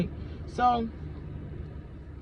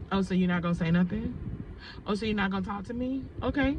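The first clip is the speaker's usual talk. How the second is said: soft, needling imitation; passive-aggressive coworker; 6 dB quieter than usual. Coworker snubbed by the speaker, quietly passive-aggressive. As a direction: imitate her making pointed remarks under her voice.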